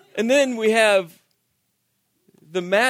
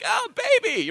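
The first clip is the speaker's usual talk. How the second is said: put-on much higher, thrilled "oh baby"; excited cry; 13 semitones higher than usual. Dad seeing his first child born, thrilled cry. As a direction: burst out with delight. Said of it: "I was so excited".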